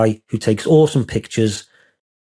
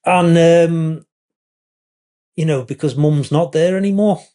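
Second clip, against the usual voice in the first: holding back tears as you speak; a touch of choked-up sadness; choking up; 6 semitones higher than usual. Real, not acted. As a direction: voice catching with real emotion. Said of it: "I am actually making myself choke up".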